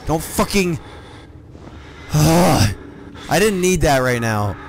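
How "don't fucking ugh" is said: furious high yell; angry shouting. Real, not acted. Attacked by enemy.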